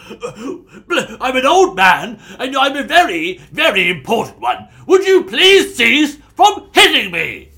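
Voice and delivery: put-on high, loud, slow, quavering old man; pompous old man. Old man begs the dwarf to stop hitting him, high pompous whine.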